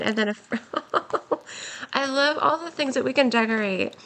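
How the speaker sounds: ordinary delivery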